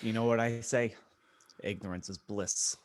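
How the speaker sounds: usual speaking voice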